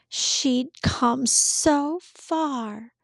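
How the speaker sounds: plain reading voice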